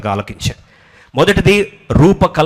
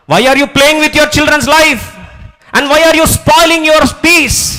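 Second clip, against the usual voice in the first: higher, louder, very slow pleading rebuke; full-force preaching; 13 semitones higher, 6 dB louder, much slower than usual. Acted. Preacher rebuking parents who compare their children, slow full-force preaching. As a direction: stretch each word out as a plea.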